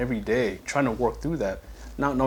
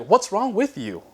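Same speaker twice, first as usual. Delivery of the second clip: judgmental question; 9 semitones higher, slower than usual. Retold or imitated. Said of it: "you might come up to me like".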